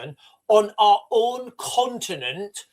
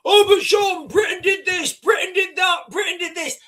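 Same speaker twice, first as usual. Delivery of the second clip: high, loud whiny mock voice, far higher; mocking chat voice; 11 semitones higher, 5 dB louder than usual. Mocking commenters in the chat, high whiny shout. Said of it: "people on the chat now".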